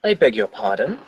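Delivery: very posh British, politely questioning; posh British accent. Acted. Confused man to the stranger who sat on him, posh British accent. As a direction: put on a plummy upper-class British accent. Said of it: "says in a very posh British accent".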